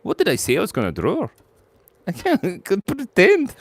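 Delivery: usual speaking voice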